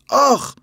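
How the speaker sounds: sudden high yelp of pain; sharp cry of pain. A narrator's reading. Boy is kicked by the caretaker, high yelp.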